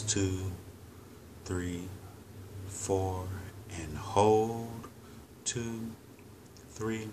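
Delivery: slow, measured counting with long pauses; calm, paced meditation guide; guided breathing meditation. Real, not acted. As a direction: lead them through each breath unhurriedly, drawing out the instructions.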